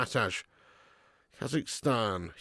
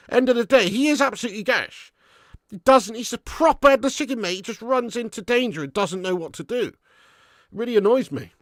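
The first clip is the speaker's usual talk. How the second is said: exasperated rant; 8 semitones higher, 4 dB louder than usual. Real, not acted. Fan rants about winger.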